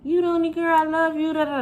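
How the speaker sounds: hammed-up two-faced family member; fake-sweet relatives. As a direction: sing it like a phony aunt laughing in your face. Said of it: "Laughing up in your face".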